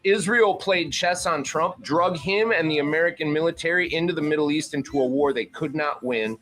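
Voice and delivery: usual speaking voice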